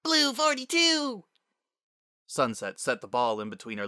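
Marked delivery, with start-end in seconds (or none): 0.0-1.2 high, loud, drawn-out play call; squeaky child quarterback yell; young girl's cracking shout. A narrator's reading. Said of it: "Spitfire's voice cracked".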